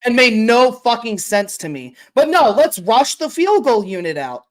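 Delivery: normal talking voice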